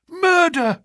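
high, alarmed blurt of the news; the butler breaks it, aghast; shocked butler cry. A narrator's reading. Butler announcing a killing.

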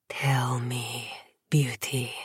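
low breathed seduction; breathy whisper. Acted. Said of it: "he breathed".

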